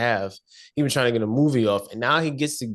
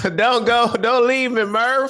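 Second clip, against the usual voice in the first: McConaughey impression; 10 semitones higher, 5 dB louder than usual. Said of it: "Matthew McConaughey is Matthew McConaughey".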